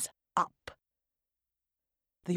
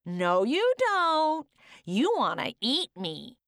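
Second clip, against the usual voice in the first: gingerbread man voice; 8 semitones higher than usual. Acted.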